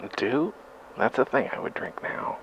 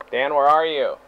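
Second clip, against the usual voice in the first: normal talking voice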